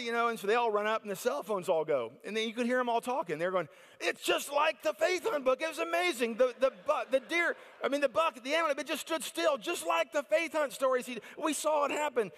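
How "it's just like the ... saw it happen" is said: high-pitched breathless wonder; amazed excitement. Retold or imitated. Hunters telling friends on the phone about the buck, high and amazed. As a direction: babble like you just saw a miracle.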